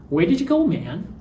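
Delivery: high, accusing Where did you go man; reproachful question. Shown as a demonstration. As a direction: demand why they left. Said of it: "you should have stayed here".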